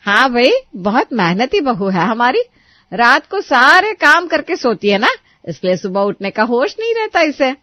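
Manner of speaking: mother-in-law mocking bride, sarcastic. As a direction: praise her mockingly. Sushila sarcastic.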